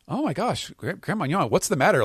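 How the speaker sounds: high, swooping cartoonish concern; mock alarm. Note-taking girl reacts to grandma's face, mock alarm. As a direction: ask in a high, shocked girl's voice.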